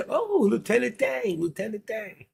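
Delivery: put-on high, drawled Southern accent; playful Southern accent impression. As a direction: call out like a Southern shrimp-boat man. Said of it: "I love that accent".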